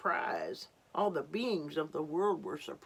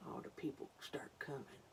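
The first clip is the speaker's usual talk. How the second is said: whisper; 3 semitones lower, 13 dB quieter than usual. Real, not acted. Repeat to children, whispered.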